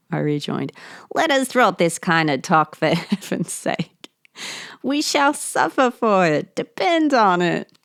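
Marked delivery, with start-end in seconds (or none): 1.1-7.7 louder, higher, pleading to drop the talk; a narrator character who is high and rattled; narrator's voice, rising. Acted. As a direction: push it up higher and louder as he insists. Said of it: "My only excuse to the voices".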